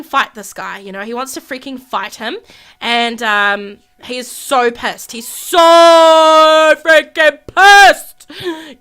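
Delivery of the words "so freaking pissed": loud, very slow, stretched out; loud drawn-out outrage; loud emphatic. Real, not acted. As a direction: drag it out loudly for emphasis.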